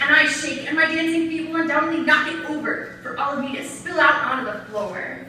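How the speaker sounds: impassioned slam declaration; forceful rhythmic performed poetry; spoken-word poem. Acted. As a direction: declaim it to the room.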